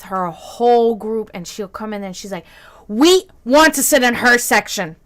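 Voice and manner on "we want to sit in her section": calling out. Retold or imitated. Golfer with her group points out their server, calling out loudly. Golfer calls out across the restaurant.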